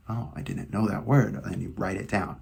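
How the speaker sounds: usual speaking voice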